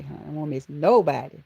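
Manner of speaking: ordinary delivery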